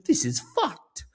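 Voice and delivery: high, drawn-out mock dismay; slow, high-pitched imitation of appalled English friend; [name]. Imitating English friend let down by the pub, mock appalled. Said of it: "she's like".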